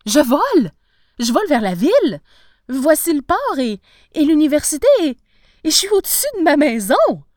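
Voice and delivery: loud, high bursts of amazement; astonished exclaiming. A narrator's reading. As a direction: call out each sight in breathless wonder. Professor exclaims as he flies.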